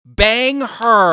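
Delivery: mock exasperation.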